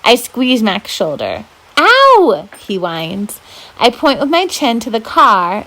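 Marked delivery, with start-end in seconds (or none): 1.8-2.5 yelp of pain. Acted. Brother hurt.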